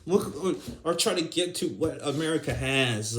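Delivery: ordinary talk, nothing marked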